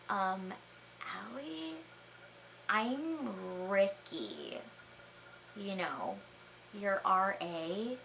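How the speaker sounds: soft, slow, hesitant mumbled reply; timid, bewildered man, imitated; imitated quiet confusion. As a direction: say it very softly with long awkward pauses. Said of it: "he just gets really quiet and is looking at me totally confused".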